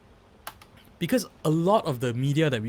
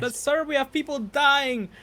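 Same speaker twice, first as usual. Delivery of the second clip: pleading reporter, higher and slow; mock reporter; 11 semitones higher than usual. Reporter pushes back at a press conference, pitched up and slowed. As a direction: press the president like a worried reporter. Playing a reporter questioning the president.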